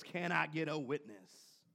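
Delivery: lightly put-on preacher voice. Shown as a demonstration. Preacher mimicking preachers for the congregation, light preacher voice. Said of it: "we'll say it in our preacher voice".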